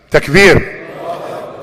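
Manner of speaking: rallying call. Real, not acted. Leader calls the congregation to praise, rallying call. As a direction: call out for the crowd's response.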